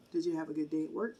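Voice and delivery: ordinary talk, nothing marked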